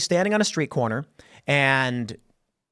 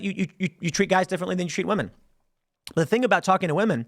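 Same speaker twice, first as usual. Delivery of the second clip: normal talking voice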